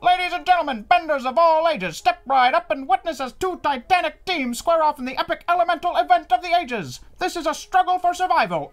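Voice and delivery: booming high arena announcer. Shown as a demonstration. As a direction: hype up the crowd like a ring announcer. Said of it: "I'm going to go into my announcer voice".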